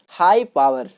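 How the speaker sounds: usual speaking voice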